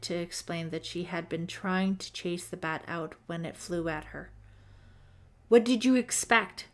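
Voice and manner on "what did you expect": 1 scornful scolding. A narrator's reading. Deborah scolding Holly.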